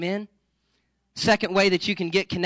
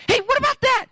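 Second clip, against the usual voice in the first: much higher, hopeful pleading voice; eager date guy; 13 semitones higher, faster than usual. Retold or imitated. Retelling TV blind date guy desperately pitching, high eager voice.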